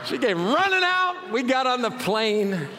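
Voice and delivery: high, laughing through the telling; real giggle as the story ends. Real, not acted. Pastor laughing as he finishes the airport story.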